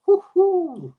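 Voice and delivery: high, slow woo-hoo; a stretched celebratory woo-hoo; drawn-out cheer. Real, not acted. Nearly ten thousand subscribers cheer.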